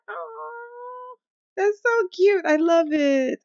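high squealing gush over something cute. Real, not acted. Cooing at a sweet moment in her game, high squeal. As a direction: melt at the cute scene, up high.

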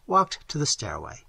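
usual speaking voice

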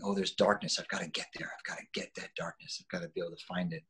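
hushed, half-whispered musing to himself; inner voice. Retold or imitated. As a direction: breathe it out like a private thought. Actor's retold inner thoughts.

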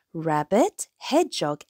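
ordinary delivery, nothing marked

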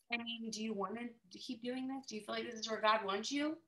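soft, concerned husband checking in on her; quiet husband. Retold or imitated. Husband quietly asks his discouraged wife, soft and concerned. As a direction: ask her gently, almost under your breath.

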